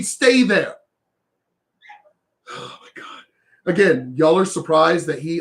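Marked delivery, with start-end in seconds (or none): 2.5-3.2 breathed out as a bare whisper; whispered disbelief. Real, not acted. Fed up with the viewers, a whispered oh my God. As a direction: exhale it in weary disbelief.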